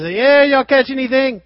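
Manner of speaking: high, loud call to a distant boat; hollering to the fishermen a hundred yards off; Jesus calling out loud to the disciples fishing; calling out across water. Retold or imitated. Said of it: "he yells out".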